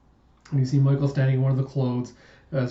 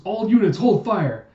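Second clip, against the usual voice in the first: high, loud urgent command; raised, forceful commander's shout; shouted order; 7 semitones higher, 5 dB louder than usual. Acted. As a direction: call out to every unit at once.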